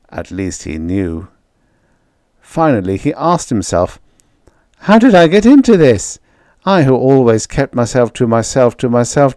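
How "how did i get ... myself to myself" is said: high, loud, frantic voice; panicked, spiralling out; unravelling man. Acted. Man losing his mind.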